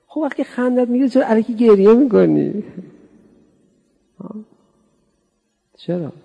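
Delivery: high, loud mock rebuke; mock scolding. Preacher scolding listeners who fake tears, loud and high. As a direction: scold them for faking tears.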